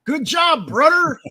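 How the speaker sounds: very high, loud cheer; shouted congratulations. Acted. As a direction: holler it out as hammed-up praise. Performing a chat message's congratulations.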